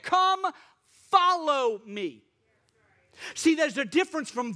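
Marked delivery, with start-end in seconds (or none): 0.0-2.2 high ringing come, slow follow me; Jesus's call. Acted. Jesus calling the disciples, high then slow. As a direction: stretch it as a solemn call. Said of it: "here's what he said".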